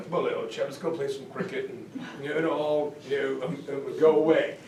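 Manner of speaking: a complacent English gent, brushing it off; mock English toff.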